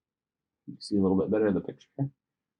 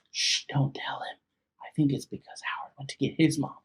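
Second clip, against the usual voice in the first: hushed secretive whisper; whisper aside; 3 semitones higher, 8 dB quieter, faster than usual. Real, not acted. Reader's aside to kids.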